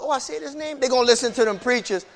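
ordinary delivery

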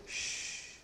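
long, soft shushing hiss; hushing shh. Retold or imitated. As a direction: hush someone who talks too much. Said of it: "God told me, he said".

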